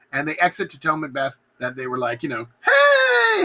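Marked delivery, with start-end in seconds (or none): 2.6-3.4 very high, loud "hey"; comic high greeting. Acted. Killers reporting back to Macbeth, high comic greeting. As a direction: sing out a sheepish mock hello.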